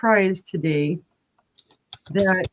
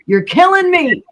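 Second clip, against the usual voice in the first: retold scream; 9 semitones higher, 6 dB louder than usual. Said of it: "Gina's screaming at the screen".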